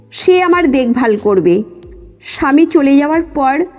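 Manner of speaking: plain reading voice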